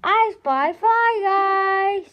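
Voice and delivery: put-on high, loud, slow gleeful boy; Buzz finding his fly. Boy spotting his fly in the can, high and slow. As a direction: sing it out slowly, found you. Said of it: "said Buzz".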